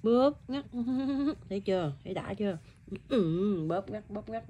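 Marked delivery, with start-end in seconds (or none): 0.0-0.6 high-pitched little squeeze words; teasing, amused show-and-tell; playful demonstration. Shown as a demonstration. Mother imitates squeezing, pinching baby. 3.7-4.5 soft, quick repeated words; hushed demonstration. Shown as a demonstration. Mother repeats it over sleeping baby.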